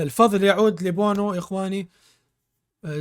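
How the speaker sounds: ordinary talk, nothing marked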